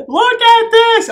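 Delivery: high, loud, drawn-out squeal of delight; thrilled. Real, not acted. Vlogger showing viewers her new wands, thrilled. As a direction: squeal it slowly, really thrilled.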